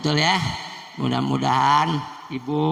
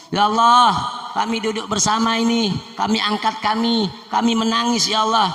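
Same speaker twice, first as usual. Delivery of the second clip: impassioned prayer; 6 semitones higher than usual. Acted. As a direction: beg God with rising passion. Prayer continues, more fervent.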